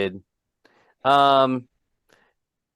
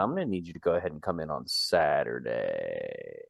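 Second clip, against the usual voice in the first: lowered, hushed boss drone; mock boss voice; 12 semitones lower, 13 dB quieter than usual. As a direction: play the smarmy office boss. Boss voice to Gracie.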